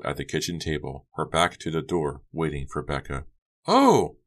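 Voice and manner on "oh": sudden high, loud "oh"; high-pitched startled exclamation; startled cry. A narrator's reading. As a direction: cry out in shock. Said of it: "Becca cried".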